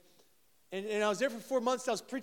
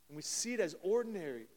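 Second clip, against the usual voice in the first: ordinary talk, nothing marked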